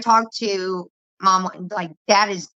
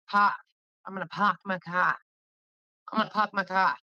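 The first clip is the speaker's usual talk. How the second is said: soft R-less East Coast "pahk my cah"; imitated East Coast accent; 5 dB quieter than usual. Trying out friend's accent on a sentence, imitated East Coast accent. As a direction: drop every R like an East Coaster. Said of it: "You forget the rest of the word".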